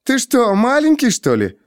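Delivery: high-pitched scornful jeer; mocking. A narrator's reading. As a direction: taunt him like a silly child. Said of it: "насмешливый хохот". (насмешливо, высоким голосом)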